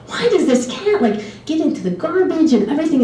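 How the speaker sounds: high-pitched retold grumble; mock exasperation, pitched high; exasperated complaint. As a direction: imitate a baffled, put-upon complaint. Retold complaint about the cat.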